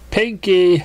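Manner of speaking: called over shoulder. Acted. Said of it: "she said over her shoulder".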